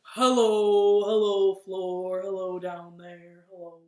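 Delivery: low, loud hellos toward the floor; kids' game, low voice toward the floor. Shown as a demonstration. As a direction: drop the voice down low. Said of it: "as low as we can".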